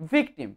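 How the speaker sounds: "ভিকটিম" with a clear English v at the start; correct V sound. Shown as a demonstration. Teacher reviewing the V rule, "ভিকটিম" said with a true v. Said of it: "ভ হয়ে গেল".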